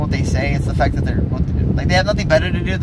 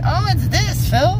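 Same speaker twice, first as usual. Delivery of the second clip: high mock voice; slower than usual. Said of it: "to be all like".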